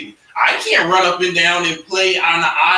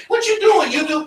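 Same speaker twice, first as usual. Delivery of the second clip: incredulous onlooker; 13 semitones higher than usual. Retold or imitated. Someone questions a churchgoer's habits, high incredulous. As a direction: squeal it in disbelief.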